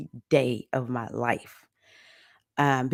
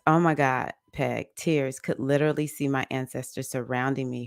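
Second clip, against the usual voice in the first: ordinary delivery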